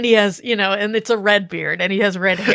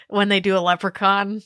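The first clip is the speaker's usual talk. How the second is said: ordinary talk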